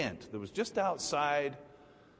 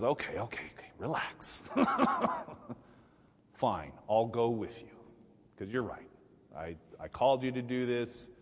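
calming God; 3 semitones lower, 3 dB quieter than usual. Acted.

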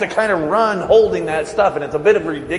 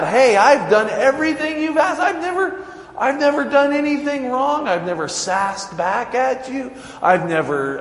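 high, whiny complaint, lightly voiced; a hint of a sulking son listing his virtues; resentful older son; 8 semitones higher than usual. Pastor voicing the older brother complaining to his dad, high and whiny. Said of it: "And he goes to his dad".

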